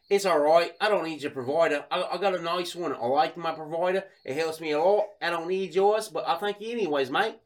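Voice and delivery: put-on English bloke, drifting Australian; English accent. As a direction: politely decline in a mock English accent. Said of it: "like, an English guy".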